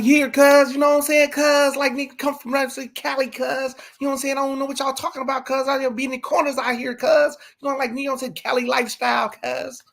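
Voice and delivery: high-pitched, put-on California street voice; mock California slang talk in a high, steady pitch; Cali accent.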